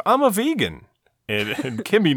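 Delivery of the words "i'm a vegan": higher, excited exclamation; exclaiming. Retold or imitated. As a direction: blurt it out proudly. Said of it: "he exclaims".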